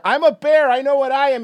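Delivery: high, loud and proud; loud proud declaration. Retold or imitated. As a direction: call it back over your shoulder. Shouting back to improv actor.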